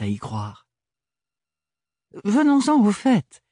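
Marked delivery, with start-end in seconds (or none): 2.2-3.4 high, bright and brisk; gleefully moving on; cheerful. Acted. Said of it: "enchaîna-t-il joyeusement".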